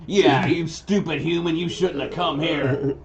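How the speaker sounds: high goblin heckling; goblin insult. Acted. Goblin hurling insults at a human, high taunting voice. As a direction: sneer at the intruder as a goblin. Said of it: "Or just hurl insults".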